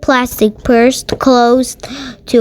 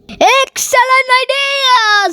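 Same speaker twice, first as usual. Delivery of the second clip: teacher's voice lifted well above the narration; high teacher voice; 13 semitones higher than usual. Acted. Mister Slinger agreeing with Lily, in a high pleased voice.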